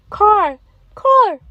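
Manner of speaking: put-on eager child repeating "alligator"; kids repeating. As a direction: squeal it back like a small pupil. Pretend pupils repeat the word.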